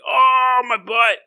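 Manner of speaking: mock pained old man; a fallen old man, lightly voiced; old man's yelp. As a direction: cry out a little as he falls. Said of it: "He's like".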